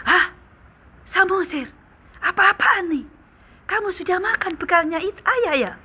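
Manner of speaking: father catches his son eating his lunch, shocked cry. Acted. Father finds his food eaten.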